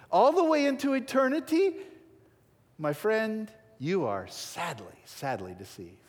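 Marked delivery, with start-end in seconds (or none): none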